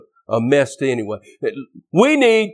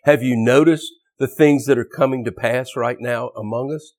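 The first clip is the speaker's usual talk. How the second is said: ordinary talk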